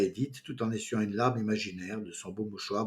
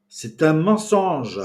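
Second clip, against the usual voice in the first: slow, louder and higher, each word hammered; shouting; 6 semitones higher, 10 dB louder, much slower than usual. A narrator's reading. Pascal shouting down the teasing about his aunt, slow and loud. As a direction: yell the denial, outraged. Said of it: "cria à nouveau Pascal".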